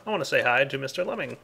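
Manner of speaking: ordinary talk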